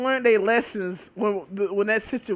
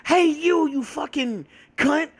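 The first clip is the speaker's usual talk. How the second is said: mock angry yelling; 6 semitones higher than usual. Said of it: "I come say".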